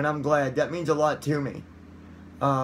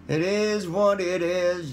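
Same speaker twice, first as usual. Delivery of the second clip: put-on hillbilly accent; 3 semitones higher, slower than usual. Goofy voice repeat.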